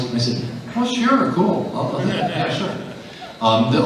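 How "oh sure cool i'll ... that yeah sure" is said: eager yes. Retold or imitated. Retelling his own eager reply.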